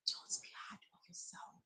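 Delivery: soft breathy whisper; hushed, intimate whisper. Real, not acted. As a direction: whisper it gently to the listener. Tender advice whispered to viewers.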